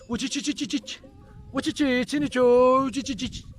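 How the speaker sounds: high chirping call to the flock. Shown as a demonstration. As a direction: chirp the call lightly, half laughing. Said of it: "biraz Çince oluyor".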